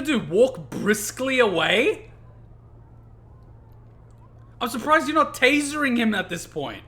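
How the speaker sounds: loud, high, indignant shouting; furious sarcastic yelling; outraged incredulous rant. Real, not acted. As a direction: rage at the absurd arrest. Ranting at police brutality.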